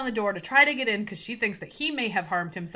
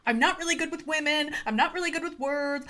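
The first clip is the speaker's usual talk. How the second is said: higher-pitched, eager, awkward nice-guy voice; nerdy awkward suitor; 7 semitones higher than usual. Retold or imitated. Romance archetype admitting he likes her, eager and pitched higher. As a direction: play the shy nerdy guy confessing, pitched up. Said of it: "where you get that archetype".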